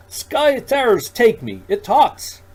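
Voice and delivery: high, loud, slow cry of alarm; shocked that the stranger can speak; startled shriek. Acted. Shopkeeper startled by Roger.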